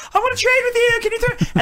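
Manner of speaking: squeaky high retold ask; high voiced quote. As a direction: pitch way up, playful. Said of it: "I would be like".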